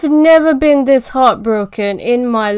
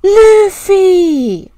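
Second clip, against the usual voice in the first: high, loud cry of the name; anguished shout; 9 semitones higher, 7 dB louder than usual. Real, not acted. Viewer crying out Luffy's name at the screen, anguished shout. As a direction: yell his name in despair. Yelling at the fight scene.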